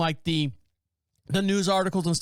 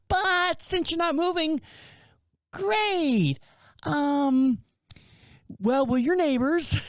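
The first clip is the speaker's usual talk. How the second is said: much higher, slow, put-on neighbor voice; mock nosy neighbor; 10 semitones higher, much slower than usual. Nosy neighbor admitting they hoped to grab the barn stuff, in a high, slow mocking voice. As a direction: lampoon a disappointed neighbor, sugary and slow. Neighbor retold at garage sale.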